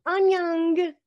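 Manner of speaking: careful learner repeating the Korean greeting; second try at Korean. Shown as a demonstration. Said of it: "with an N G at the end".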